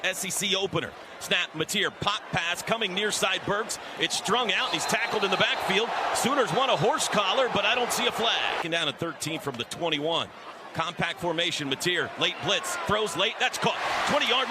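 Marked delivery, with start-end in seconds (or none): none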